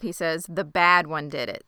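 ordinary delivery

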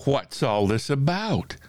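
mild storybook shout of curiosity. A narrator's reading. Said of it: "the people came shouting".

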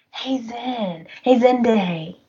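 mock-casual singsong hello; angling for gossip, lightly hammed up; playfully nosy. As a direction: sidle up with a nosy greeting. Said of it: "She's all nosy".

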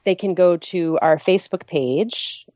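ordinary delivery

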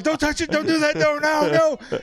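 high, loud frantic pleading. Retold or imitated. Friend begging him not to grab the fish, high frantic cries. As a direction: squeal at him to stop. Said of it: "Sally goes".

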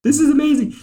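very high, excited mock squeal; retold freak-out. Fan answering a baffled Sarah, acting out his freak-out. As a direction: squeal it, thrilled out of your mind. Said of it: "I'm, like, freaking out".

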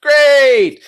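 sarcastic high, drawn-out great; strained sarcastic delight; fake cheer. Real, not acted. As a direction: force the cheer through gritted teeth. GM forced cheer after bad roll.

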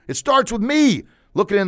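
high, loud declaration; yelled affirmation. Shown as a demonstration. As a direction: yell it out like a rallying cry. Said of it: "yell it".

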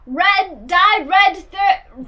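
high, loud, slow mock American accent; American call. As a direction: holler it out like a caricature American. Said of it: "do an American call".

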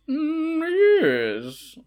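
high drawn-out hum into "yes"; mock old-timey voice. Shown as a demonstration. Co-host demonstrating the guessed accent, mock old-timey voice. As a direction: imitate a vintage put-on voice. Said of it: "like this, kinda?".

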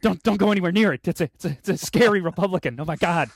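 loud, high, stammering fake terror; play-acted frantic warning; mock panic. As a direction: panic as if a monster is right there, hammed up. Parodies spider panic with a Republican.

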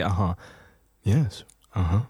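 low cool acknowledgement; cool low uh-huh. Shown as a demonstration. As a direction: sound cool and laid back. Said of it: "listen how cool I sound".

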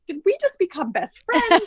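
high, gleeful movie-line quote; a playful nod to the Step Brothers line. Retold or imitated. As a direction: pitch it up like a giddy kid. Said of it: "It was like full Step Brothers".

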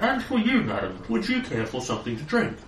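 high polite robot butler; prim machine waiter voice. Acted. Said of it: "more of a robot voice".

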